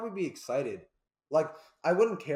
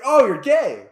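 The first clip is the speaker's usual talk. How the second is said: higher, louder happy yell; excited shout; 10 semitones higher, 17 dB louder, much slower than usual. Retold or imitated. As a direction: blurt it out with delight. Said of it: "it'd be like".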